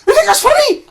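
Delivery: high, loud, strained scolding; exasperated head of sixth form. Retold or imitated. Head of sixth form scolds the boys, high loud strained. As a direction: lecture the boys, voice cracking with outrage. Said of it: "he goes".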